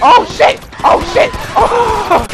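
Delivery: loud, high-pitched startled yells; high, loud alarm at an ambush; panicked shout. Real, not acted. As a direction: yell out as if suddenly under fire. Suddenly shot at in game.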